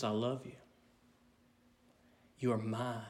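soft, low and gentle; hushed tender voice of God; still small voice. Acted. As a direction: speak it softly like God comforting. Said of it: "still, small voice".

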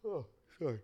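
speaking through a yawn; words stretched by a yawn. Real, not acted. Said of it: "I'm yawning".

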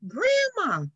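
high-pitched call for grandma; child's excited yelling. Retold or imitated. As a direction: call out like a little girl hollering. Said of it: "She was yelling".

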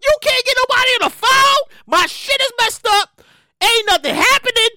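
high, loud, drawn-out exasperated cry; frustrated outcry. Retold or imitated. Angry customer yelling at the streaming company. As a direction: demand answers from a dead phone line. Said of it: "I was like".

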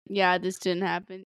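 flat, certain mock denial; confident denial. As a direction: say it with total confidence. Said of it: "Mariah said it all confidently".

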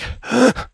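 sharp high gasp for air; wounded man's heavy gasp; ragged gasp. Acted. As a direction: gasp like every breath hurts. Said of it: "Breathing very heavily, unsteadily".

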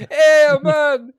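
loud, high laughing cry; excited shout. Real, not acted. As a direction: yell it out in delight. Reacting loudly, laughing.

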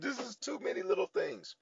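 very high-pitched fed-up protest; exasperated complaint. Retold or imitated. Acting out frustrated complaint to God.

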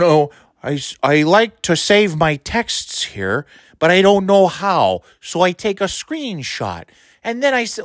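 dopey Midwestern judge imitation; mocking Wisconsin accent. Host imitating the judge saving texts, mock Midwestern accent. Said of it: "Wisconsin Midwestern fucking horseshit accent".

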